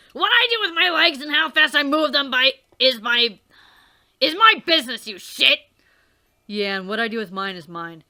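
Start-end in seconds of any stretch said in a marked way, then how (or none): 0.1-5.6 angry yell. Acted. Dave snaps at the other character, angry shout. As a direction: bark it back at him.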